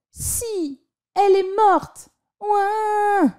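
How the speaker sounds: put-on high sobbing child's voice; child crying. A narrator's reading. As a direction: wail through tears like a kid. Child crying over ending.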